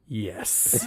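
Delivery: low, quiet deadpan yes; mock serious. Boy lying to a classmate, deadpan. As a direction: say it dead serious, barely audible. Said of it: "I said".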